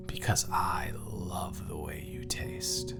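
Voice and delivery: slow, very soft near-whisper; intimate whisper. Acted. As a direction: savour it under your breath. Intimate confession to partner.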